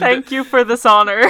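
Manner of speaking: loud, high, hammed-up acceptance; mock grand thanks. Winning contestant shouting thanks for the honor, hammed-up.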